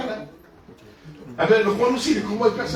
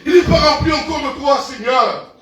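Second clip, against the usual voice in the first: raised, fervent prayer voice; 4 semitones higher than usual. Acted. Preacher leading the prayer, fervent and raised. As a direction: cry out to the Lord.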